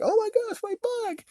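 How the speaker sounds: squeaky high falsetto gasp; mock her in a tiny high voice; mock girly voice. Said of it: "It's like".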